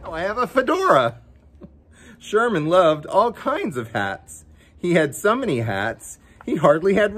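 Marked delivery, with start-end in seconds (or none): none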